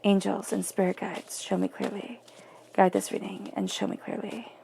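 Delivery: hushed card-reader's prayer; murmured invocation. Acted. Murmured invocation over the cards.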